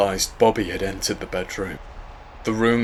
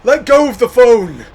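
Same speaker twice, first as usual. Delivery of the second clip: high, loud urgent shout; 13 dB louder than usual. Acted. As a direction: shout the order over the storm. Yelling at boy in storm.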